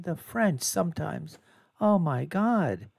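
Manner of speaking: ordinary delivery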